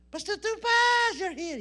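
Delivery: high, loud, drawn-out call across the room; startled shout of recognition; surprised call. Retold or imitated. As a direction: call his name out in happy surprise. Pastor spots him, calls out.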